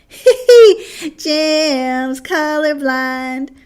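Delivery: high giggling taunt, slowly drawn out; a little sister mocking her brother; teasing giggle. A narrator's reading. Child teases brother.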